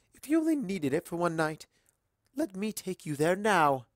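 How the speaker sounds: a gracious society mare welcoming a stranger; Rarity, elegant and gracious. A narrator's reading. Rarity speaking eagerly.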